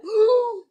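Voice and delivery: high, loud mock gasp; imitated shocked gasp. Three girls hear the rude words in the elevator, mock gasp. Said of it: "the three girls go".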